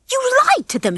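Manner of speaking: high-pitched, furious accusation. A narrator's reading. Ginger confronts Rocky about his promise, furious. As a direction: accuse in outrage. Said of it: "she said furiously".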